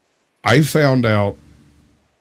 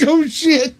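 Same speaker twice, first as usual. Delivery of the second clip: dismayed laughter at the news; laughing "oh shit". Retold or imitated. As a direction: crack up as you say it. Laughing reaction to the count.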